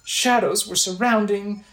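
acted worried game character; Impa, shaken and earnest. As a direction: plead with the hero, dramatic and grave. Voices Impa after possession.

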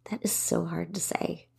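real under-the-breath whisper; whispered aside. Real, not acted. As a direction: drop to a whisper, as if to yourself. Muttered aside after tongue-twisting web address.

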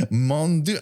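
put-on half-hearted French accent. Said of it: "I do not do accents".

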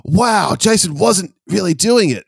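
high, gasping wonder; mock amazed child. Host recalling the childhood reaction to the twist, high mock amazement. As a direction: act out a kid's mind being blown. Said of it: "That blew my ten, eleven-year-old mind".

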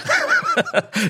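high, bright burst of real laughter. Real, not acted. Host laughing at his agency joke, real laughter. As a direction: laugh out loud at your own joke. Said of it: "So I'm laughing".

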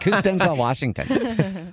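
laughing, slow, clueless question; mock clueless. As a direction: play dumb with a laugh. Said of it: "She's like".

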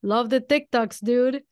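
sarcastic jab, imitated and projected; mock sarcastic. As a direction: imitate a rival's sarcastic praise. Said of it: "and sarcastically say".

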